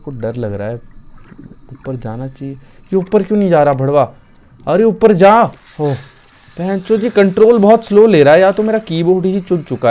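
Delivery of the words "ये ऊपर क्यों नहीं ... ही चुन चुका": loud, high frustrated yelling; frustrated shout. Real, not acted. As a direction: yell at the laggy controls. Character won't climb, slow controls.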